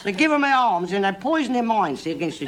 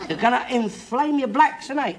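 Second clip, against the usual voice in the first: ordinary talk, nothing marked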